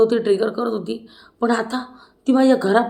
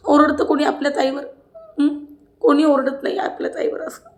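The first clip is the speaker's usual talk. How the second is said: imitating an aggrieved, higher-pitched voice; mock reproach, pitched up and insistent; Deepali's hurt complaint; 5 semitones higher than usual. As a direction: play the wronged elder sister, voice raised in pitch. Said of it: "असं दीपालीचं म्हणणं असतं".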